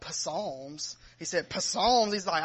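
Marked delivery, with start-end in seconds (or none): 0.1-1.0 "Psalms" sounded with its P, puh-SALMS; comic mispronunciation. Retold or imitated. Newcomer naming a Bible book, mispronouncing "Psalms" as puh-salms. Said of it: "it's funny how he says it in the video". 1.5-2.1 louder, higher, puzzled "Psalms" as puh-SALMS; comic mispronunciation. Retold or imitated. Baffled newcomer reading the title, mispronouncing "Psalms" as puh-salms. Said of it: "it's funny how he says it in the video".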